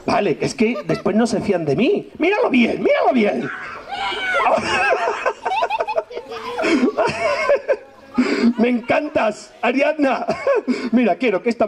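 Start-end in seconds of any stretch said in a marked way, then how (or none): 2.2-3.5 teasing shout. Acted. Teasing child to look, laughing. 3.6-7.8 very high, shrieking burst of laughter. Real, not acted. Huge laugh at the joke.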